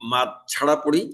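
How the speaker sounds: ordinary delivery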